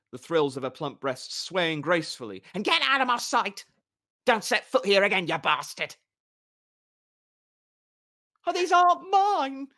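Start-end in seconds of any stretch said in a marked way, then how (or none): 2.5-6.0 high, loud shrill-free yell from a balcony; screaming. A narrator's reading. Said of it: "the blonde woman screamed". 8.5-9.7 high, loud cry of surprise; astonished. A narrator's reading. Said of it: "Dandelion said in astonishment".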